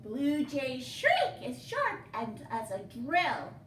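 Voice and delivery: ordinary delivery, nothing marked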